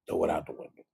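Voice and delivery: whispered repeat, unvoiced. Real, not acted. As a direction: breathe it out as a whisper.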